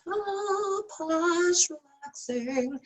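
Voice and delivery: mock relaxed scorpion. Scorpion riding the frog, mock posh and relaxed. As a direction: lounge like a smug scorpion on holiday. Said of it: "he's gonna be sitting there".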